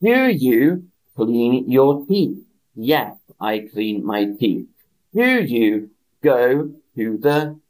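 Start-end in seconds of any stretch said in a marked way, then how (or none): 0.0-2.4 "Do" leaned on, slow model question; slow and clear, lean on "Do"; stressed do. Shown as a demonstration. Teacher modelling a question for learners, stress on "Do". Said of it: "teacher models question".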